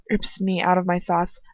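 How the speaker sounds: ordinary delivery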